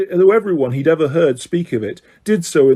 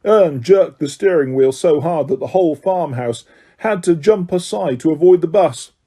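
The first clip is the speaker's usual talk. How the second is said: plain reading voice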